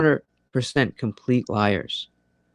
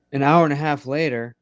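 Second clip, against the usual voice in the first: usual speaking voice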